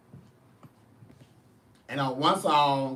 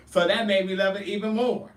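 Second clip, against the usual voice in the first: ordinary delivery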